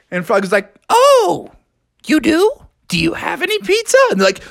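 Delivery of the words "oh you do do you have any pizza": high squeaky wonder; surprised frog. Acted. Froggy asks the turtles for pizza, surprised. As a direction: perk up with sudden interest.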